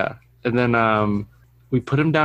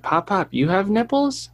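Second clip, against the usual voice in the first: put-on child's voice; 7 semitones higher than usual. Son quoted by grandparent.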